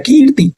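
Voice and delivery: long ee in कीर्ति, then let it fall; drawn-out long ee. Shown as a demonstration. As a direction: stretch the first vowel of कीर्ति. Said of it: "थोड़ा खींच के बोलते हैं".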